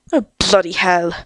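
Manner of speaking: ordinary delivery, nothing marked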